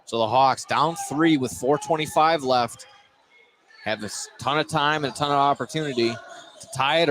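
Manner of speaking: ordinary delivery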